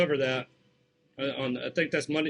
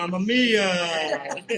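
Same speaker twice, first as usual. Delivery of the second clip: slow, drawn-out Italian "Mamma mia"; Italian accent; much slower than usual. Pretend. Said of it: "That's my country Italian accent".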